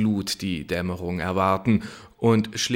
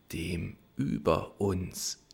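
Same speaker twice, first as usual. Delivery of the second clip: softer, slower musing repeat; quiet, slow echo; 3 semitones lower, 7 dB quieter, slower than usual. A narrator's reading. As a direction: let the phrase hang softly. Narrator echoes phrase. (leise, langsam nachdenklich)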